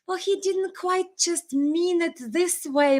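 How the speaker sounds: mock high, slow, sheepish excuse voice; mocking staffer. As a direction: whine it out like a staffer backpedalling. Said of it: "I was like".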